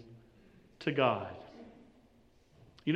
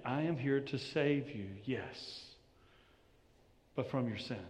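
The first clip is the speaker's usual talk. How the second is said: soft-spoken Jesus; 5 semitones lower, 9 dB quieter than usual. Retold or imitated. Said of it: "Jesus is saying".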